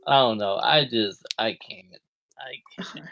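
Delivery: normal talking voice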